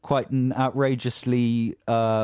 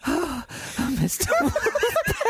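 excited; slower than usual. Pretend. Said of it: "As if she's really excited".

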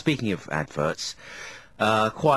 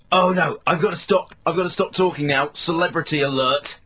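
bloke on the phone; 5 semitones higher, 3 dB louder than usual. Retold or imitated. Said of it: "and he goes".

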